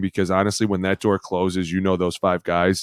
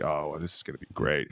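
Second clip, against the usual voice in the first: much softer, lower relishing mutter; imitated low amused aside, much softer; amused dry aside; 5 semitones lower, 11 dB quieter than usual. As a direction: savor the coming fight quietly. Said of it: "Firster just sat back and was like".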